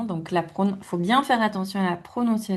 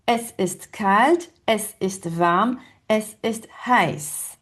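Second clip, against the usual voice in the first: es ist kalt, es ist warm, es ist heiß said slowly, word by word; slow German demo; slower than usual. Shown as a demonstration. Teacher to students, modelling German slowly. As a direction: spell out each weather phrase slowly for learners.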